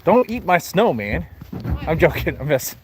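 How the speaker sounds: ordinary delivery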